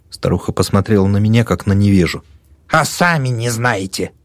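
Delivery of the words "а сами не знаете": put-on old woman voice.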